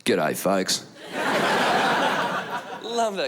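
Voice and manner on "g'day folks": low Aussie celebrity greeting; low, blokey Australian; Russell Crowe. Retold or imitated. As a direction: open a speech in a low Australian voice. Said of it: "start every speech with the same words".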